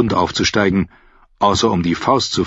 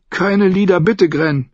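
pleading; 11 semitones higher than usual. A narrator's reading. Sam begs Grenn for no songs, higher and pleading. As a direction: plead for it to stop.

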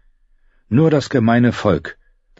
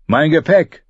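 high, raised-voice demand; calling out; 9 semitones higher, 3 dB louder than usual. A narrator's reading. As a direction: call across to the ship's captain. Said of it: "rief er dem Kapitän zu".

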